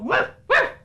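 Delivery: high yappy puppy barks, wouf wouf; dog barking. Pretend. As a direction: yap like a tiny dog for the shadow puppet. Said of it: "Doudou, le pitou". (aboiement aigu de petit chien)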